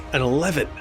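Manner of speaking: usual speaking voice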